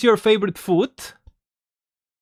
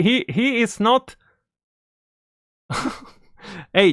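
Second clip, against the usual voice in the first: ordinary delivery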